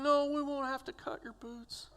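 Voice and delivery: high, gently tearful reply; imitating crying nurses. Nurses answer patient about his boots, mildly tearful. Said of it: "and they're crying".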